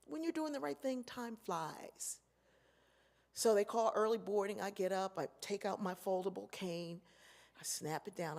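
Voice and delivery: normal talking voice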